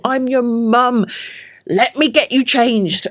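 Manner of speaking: slow, deliberate mum voice; firm mum. Retold or imitated. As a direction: spell it out slowly to the child. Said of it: "And I'm like".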